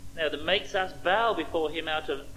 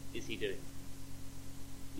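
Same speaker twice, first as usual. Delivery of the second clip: hushed whisper; 8 semitones lower, 13 dB quieter, much slower than usual. Real, not acted. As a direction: drop to a slow whisper on is he doing it. Sermon contrast: how versus why.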